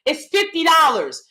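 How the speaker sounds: normal talking voice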